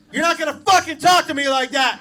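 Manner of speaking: loud, high angry yell at her; retold scream. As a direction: bellow it like an old man losing his temper. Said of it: "And then he just screams".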